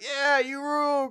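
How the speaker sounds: high mock cheer, held back in volume; high-pitched pretend cheering; cheering crowd. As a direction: imitate an adoring crowd from a distance. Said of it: "and everyone's like".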